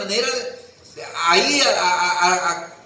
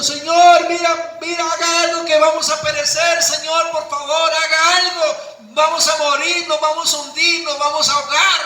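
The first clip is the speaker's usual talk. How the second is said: higher, urgent pleading for help; panicked plea; 5 semitones higher than usual. Retold or imitated. Disciples begging the Lord in the storm, higher and urgent. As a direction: beg him to save us before we sink.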